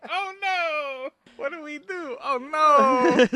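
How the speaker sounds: very high, wailing fake despair; mock panic. Townspeople hearing the bandit is coming, mock panicked wail. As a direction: wail helplessly, hammed-up and high.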